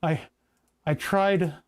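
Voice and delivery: talking through hiccups; interrupted by hiccups; hiccuping. Real, not acted. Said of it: "oh my God, I'm hiccuping".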